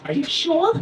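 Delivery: put-on high, questioning kid voice; Tommy. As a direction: imitate a doubting first-grader. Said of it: "He goes".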